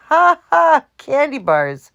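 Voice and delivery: high, loud ha-ha into the words; bursting with laughter at the thieves; laughing. A narrator's reading. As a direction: laugh out the news. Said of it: "the laughter beginning again".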